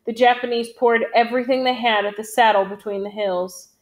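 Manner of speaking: ordinary delivery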